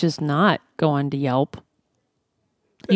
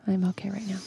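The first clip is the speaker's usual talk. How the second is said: hushed, voiceless aside; very soft whispered repeat; 12 dB quieter than usual. Real, not acted. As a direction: whisper it as if confidentially.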